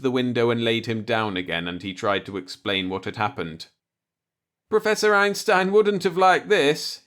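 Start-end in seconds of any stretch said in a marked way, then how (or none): 4.7-7.0 moaning. A narrator's reading. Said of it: "he moaned".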